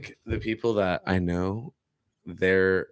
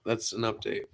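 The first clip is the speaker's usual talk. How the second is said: ordinary talk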